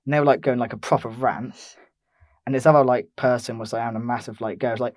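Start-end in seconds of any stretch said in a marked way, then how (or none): none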